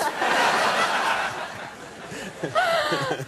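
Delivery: long, high burst of laughter; big laugh. Real, not acted. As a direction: crack up at the joke. Laughs at host's joke.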